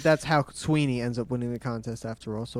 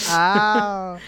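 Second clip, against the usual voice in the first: raised, drawn-out mock ow; badly acted ow; 5 semitones higher, 4 dB louder than usual. Co-host mimicking the worst acting, hammy ow. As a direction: echo a hammy, fake cry of pain. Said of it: "the worst acting".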